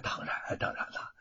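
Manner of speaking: hushed, fawning agreement; obsequious whispered yes. Acted. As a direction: whisper a servile of course, of course. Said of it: "范谷诺诺连声".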